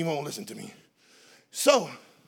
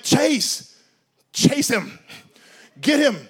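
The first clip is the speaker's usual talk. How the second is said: urging call; 4 semitones higher, 3 dB louder, much slower than usual. Retold or imitated. Urging son to chase brother.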